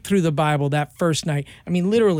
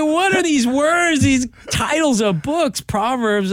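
high, loud, baffled exclamation; baffled by strange book titles; high and loud while reading odd book titles; amazed; 9 semitones higher, 4 dB louder, slower than usual. Retold or imitated. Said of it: "I'm like".

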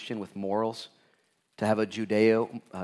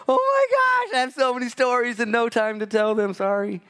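much higher, laughing excitement; 13 semitones higher, 8 dB louder than usual. Real, not acted. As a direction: gush through laughter. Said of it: "laughing aside".

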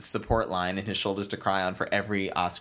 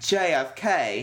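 put-on posh accent; 5 semitones higher, 4 dB louder, much slower than usual. Posh pronunciation of airport.

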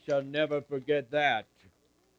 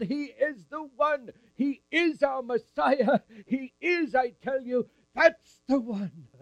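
high, loud, ecstatic proclaiming; Anna overjoyed; 10 semitones higher, 5 dB louder than usual. Acted. Anna proclaims the baby as Messiah, high and ecstatic. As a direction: jubilant old woman shouting praise to everyone. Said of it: "jump so high or move so fast".

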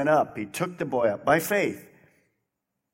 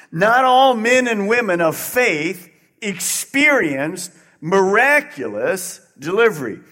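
preaching at full force; 4 semitones higher, 5 dB louder than usual. Acted. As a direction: press each word on the crowd. Preaching emphasis.

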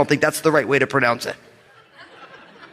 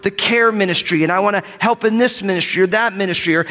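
usual speaking voice

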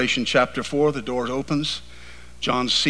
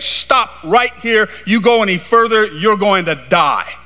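seraphim's warning; 4 semitones higher, 4 dB louder than usual. Acted.

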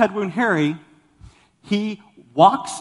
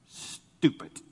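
whispered forbidden word; 7 dB quieter than usual. Acted. Preacher reading Proverbs to kids, whispering stupid. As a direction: breathe the word stupid out voiceless.

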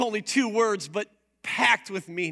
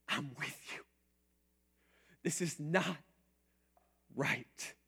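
breathy, much softer whisper of comfort; tender whisper; 4 semitones lower, 11 dB quieter than usual. Acted. Preacher voicing Jesus comforting mourners, in a hushed whisper. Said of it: "looks at the pain of people and says".

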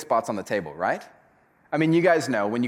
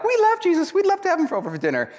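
much higher, louder, gushing mock churchgoer; mock gushing Christians; 5 dB louder, faster than usual. Pastor mocking Christians who claim to want Jesus for dinner, hammed-up gushing. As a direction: overdo the sugary eagerness of a fan. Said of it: "we as Christians were like".

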